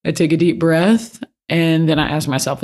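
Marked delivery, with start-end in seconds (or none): none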